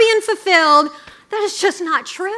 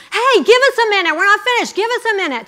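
higher, mock-casual call through a door; imitating a drunk girl putting someone off; lightly acted party girl calling out; tipsy girl brushing him off; 7 semitones higher, faster than usual. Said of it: "And she says".

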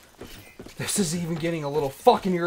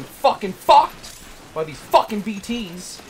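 higher, louder, slow raging rant; angry outburst; 8 semitones higher, 9 dB louder, slower than usual. Real, not acted. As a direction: spit it out, fed up. Streamer raging at enemies.

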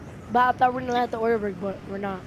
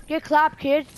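ordinary delivery

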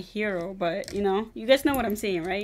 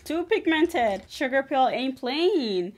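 sassy; 5 semitones higher, 5 dB louder, slower than usual. Real, not acted. Impressed by purple pigment.

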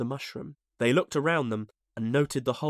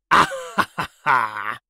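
villainous burst of laughter; 7 dB louder than usual. A narrator's reading. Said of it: "He burst into laughter".

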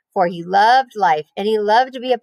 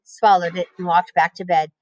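usual speaking voice

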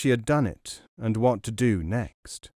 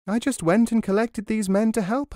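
put-on young woman's voice, pitched higher; a girl's higher voice, proud of her plan; Noor voiced higher; 10 semitones higher than usual. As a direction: lift the voice into Noor, pleased with herself.

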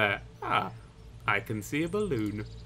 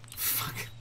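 usual speaking voice

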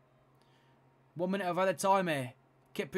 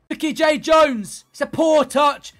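higher, louder play-by-play as the chance builds; excited commentary; 6 semitones higher, 9 dB louder than usual. Real, not acted. Commentator urging his team forward, excited and loud. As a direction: call it like the crowd is rising.

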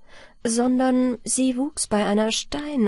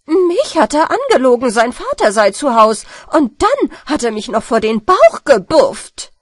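loud, higher indignant woman; calling out; 3 semitones higher, 9 dB louder than usual. Acted. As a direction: cry out the complaint. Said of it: "ruft die Frau Gemeindevorsteher".

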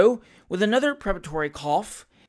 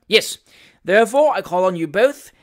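loud, self-important proclamation; resume loudly as the man in charge; official pronouncement; 3 semitones higher, 9 dB louder than usual. A narrator's reading. Magistrate resumes his pronouncement.

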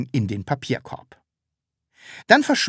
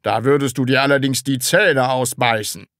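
patronising put-on boss voice; mocking; 4 dB louder than usual. A narrator's reading. Department head taunts the Sams about its teeth, mocking. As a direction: warn with a scornful edge. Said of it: "sagte der Abteilungsleiter spöttisch".

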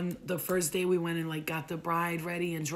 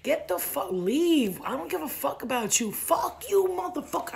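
loud angry cursing, pitched up; 8 semitones higher, 5 dB louder than usual. Retold or imitated. Woman cursing out soldiers, loud and furious. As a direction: yell curses at the soldiers. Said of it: "I start sc-, cursing at them".

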